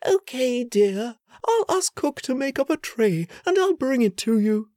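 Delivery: high, soothing motherly voice; gentle mother. Acted. Queen promising her son a tray, soothing. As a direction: reassure a sick child.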